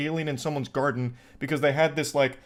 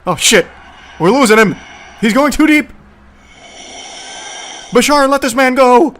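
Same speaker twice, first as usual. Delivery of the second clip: loud, much higher mock panic yells; alarmed shouting; 10 semitones higher, 12 dB louder than usual. Real, not acted. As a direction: shout like an exorcism is going wrong. Panicked reaction to channeling video.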